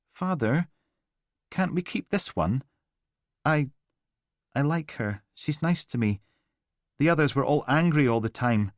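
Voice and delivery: put-on a scared little boy begging his father; child pleading. A narrator's reading.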